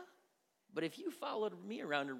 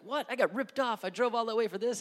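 mock indignant churchgoer; 6 semitones higher, 8 dB louder than usual. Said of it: "Some of you be like".

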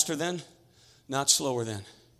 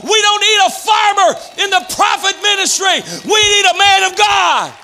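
louder and higher, building to shouts; fiery preaching; 6 semitones higher, 4 dB louder than usual. Acted. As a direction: preach it with full fire. Preaching at full force.

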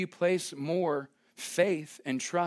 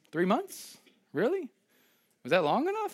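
higher-pitched, incredulous questioning; mock incredulity; 6 semitones higher than usual. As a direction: question it as if unimpressed, voice rising. God's imagined reply to impatient believer.

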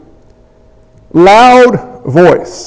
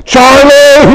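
calling out; 3 semitones higher, 3 dB louder than usual. Retold or imitated. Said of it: "we're like".

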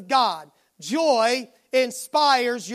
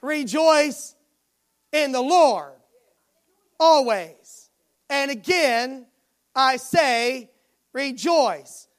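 "Rejoice... in the Lord... always" in separate phrases, long pauses between; deliberate pauses after "Rejoice", "Lord" and "always" for emphasis; emphasized words; slower than usual. Real, not acted. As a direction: preach it piece by piece, letting "Rejoice", "in the Lord", "always" each land. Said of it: "I wanted to emphasize the words".

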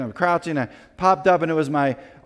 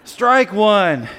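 loud; 6 semitones higher, 7 dB louder than usual. Retold or imitated. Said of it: "the lights go out when you say".

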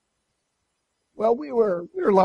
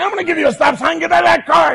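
loud, much higher enraged yell; furious shout; 8 semitones higher, 8 dB louder, faster than usual. Retold or imitated. Husband screams at wife in car, furious shout. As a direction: threaten her, all-out fury. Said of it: "I was furious".

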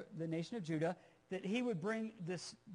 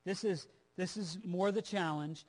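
ordinary talk

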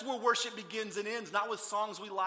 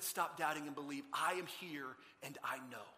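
lower, calm, unhurried appeal; gentle reassurance; 4 semitones lower, 5 dB quieter than usual. Acted. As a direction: reassure the struggler quietly. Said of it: "He engaged Thomas in his struggle and said".

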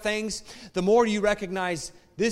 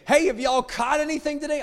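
calling out; 9 semitones higher, 5 dB louder than usual. Acted. Jesus calls from shore.

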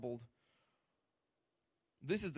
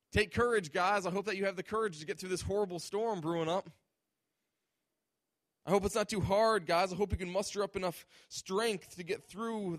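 higher, louder, hollow well-wishing from a safe distance; mock insincere sympathy; 3 semitones higher, 4 dB louder than usual. Preacher parodying a Jesus who stays on shore, mock sympathy. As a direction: pretend to care while staying put.

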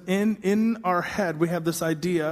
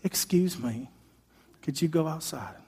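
softer, timid request; mock a timid, overly polite Jesus; meek polite Jesus; 2 semitones lower, 5 dB quieter than usual. Soft-spoken Jesus politely asks the mourners out, meek. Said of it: "he'd be like".

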